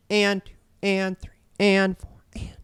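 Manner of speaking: high, slow "and", numbers nearly whispered; accented ands. Shown as a demonstration. Drum teacher counting accents on the ands, stress on AND. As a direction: punch every "and" and drop the numbers to a hush. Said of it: "accents on the and".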